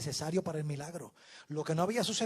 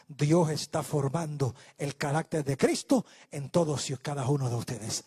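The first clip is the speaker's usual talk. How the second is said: usual speaking voice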